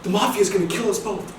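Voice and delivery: high, loud retold warning; alarmed warning. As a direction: blurt it out in mock alarm.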